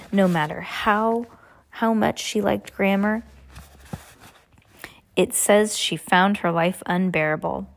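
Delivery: ordinary delivery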